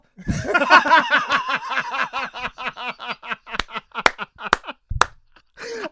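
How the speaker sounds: loud high-pitched cackling; long loud burst of laughter trailing on. Real, not acted. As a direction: laugh helplessly at your own punchline. Bursts out after own punchline.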